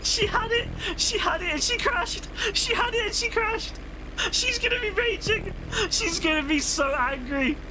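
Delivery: high, squealing laugh through the words; crying with laughter, barely getting words out; hysterical laughter. Real, not acted. Friend crashes, streamer loses it.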